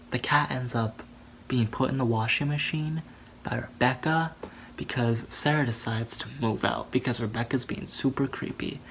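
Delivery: ordinary talk, nothing marked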